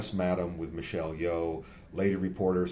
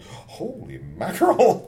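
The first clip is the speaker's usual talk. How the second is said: high, slow amazed gasp of surprise; shocked exclamation; 13 semitones higher, 6 dB louder, slower than usual. Retold or imitated. Acting out a viewer's reaction.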